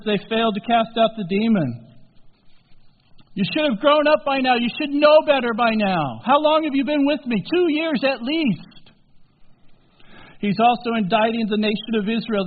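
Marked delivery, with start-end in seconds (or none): 3.3-8.9 higher, louder reproach; reproachful scolding. Acted. Jesus to failing disciples, reproachful scolding. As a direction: scold them like grown disciples.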